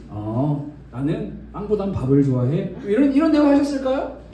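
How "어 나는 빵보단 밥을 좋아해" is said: deep, easygoing Jesus answering; gentle lower voice as Jesus at the table. Acted. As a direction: drop the voice low and answer simply. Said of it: "그럼 예수님이".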